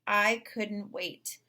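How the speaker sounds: usual speaking voice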